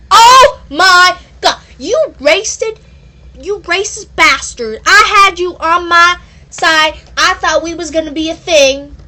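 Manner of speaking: a high, loud hammed-up outburst; mock outrage. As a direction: throw an over-the-top fit.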